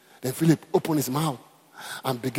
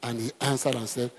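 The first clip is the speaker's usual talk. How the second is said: normal talking voice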